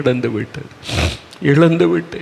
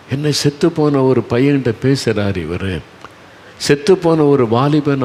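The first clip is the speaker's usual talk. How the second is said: usual speaking voice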